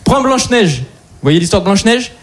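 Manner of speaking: ordinary delivery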